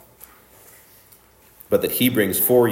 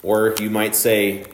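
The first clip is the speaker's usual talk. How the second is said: usual speaking voice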